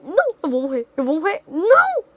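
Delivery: loud, rising panic ending in a cry; panicked. Real, not acted. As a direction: panic as you die. Panicking while dying.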